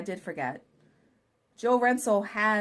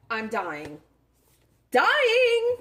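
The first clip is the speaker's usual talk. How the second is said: overacted death scene; 5 semitones higher, 7 dB louder, much slower than usual. Mock dying over cute gift.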